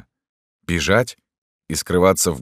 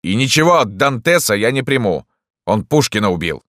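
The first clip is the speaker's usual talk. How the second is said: loud, raised objection; indignant doctor; 7 semitones higher, 6 dB louder than usual. A narrator's reading. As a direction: shout a cranky refusal. Doctor yelling at Dantes.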